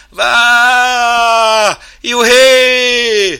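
long, high whoops at full force; joyful yelling. Acted. Chimp and mouse ride the next dip, whooping. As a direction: let out loud roller coaster whoops. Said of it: "már együtt kiabáltak".

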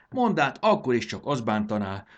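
ordinary delivery, nothing marked

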